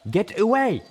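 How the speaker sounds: high, loud furious yell. A narrator's reading. Zenith yells at Velvet Remedy before charging, loud. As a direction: scream the warning at her. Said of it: "Zenith yelled".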